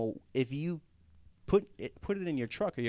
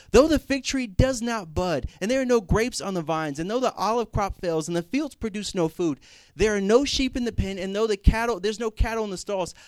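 raised, higher preaching voice; impassioned scripture recitation; 5 semitones higher, 6 dB louder than usual. Acted. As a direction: declaim the verse with force, easing toward the end.

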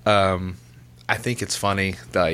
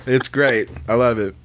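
ordinary talk

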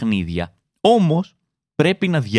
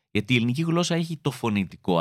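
ordinary talk, nothing marked

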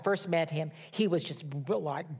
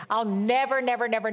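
NEVER hit higher and louder each time; repeated never stressed; 4 semitones higher, 4 dB louder than usual. Real, not acted. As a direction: pound the word never, building conviction. Emphatic personal testimony.

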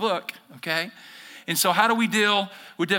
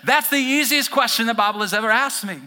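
louder, higher, indignant protest; mock defensive churchgoer; 8 semitones higher, 5 dB louder than usual. Pastor voicing a defensive listener, mock indignant. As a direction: blame the other person, pointedly. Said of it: "some of you are thinking".

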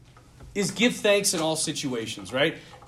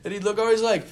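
usual speaking voice

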